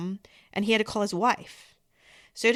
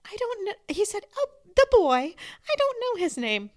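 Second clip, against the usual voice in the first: put-on much higher, innocent small boy; little boy voice; 12 semitones higher than usual. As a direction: answer like a three-year-old, simply.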